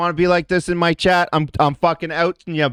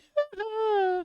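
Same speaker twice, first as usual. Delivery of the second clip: mock high giggle; 10 dB quieter than usual. Laugh after mocking whine.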